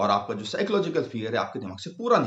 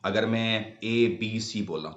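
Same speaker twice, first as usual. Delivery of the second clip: slow letters; 2 semitones lower, slower than usual. Shown as a demonstration. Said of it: "अब आप देखिए कि अगर मैं कॉन्सोनेंट्स बोलता हूं".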